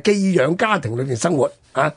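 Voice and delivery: ordinary delivery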